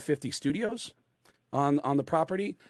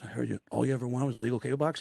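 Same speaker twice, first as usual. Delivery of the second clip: confiding rock star; 2 semitones lower, 4 dB quieter, much faster than usual. Retold or imitated. Eddie asking the comic about his illegal cable box, confiding. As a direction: bring up the shady purchase discreetly.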